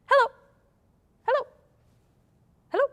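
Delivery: quick, clipped hellos in a row; fast hello. Shown as a demonstration. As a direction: fire them off as fast as you can. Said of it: "as fast as we can".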